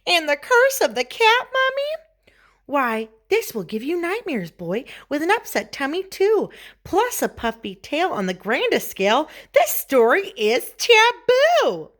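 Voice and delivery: high, swooping storybook mother; disapproving mama. A narrator's reading. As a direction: scold theatrically, building to a high, loud finish.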